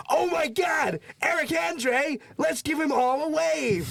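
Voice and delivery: very high, shouted giddy squeal; gushing tourists. Retold or imitated. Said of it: "they're all like".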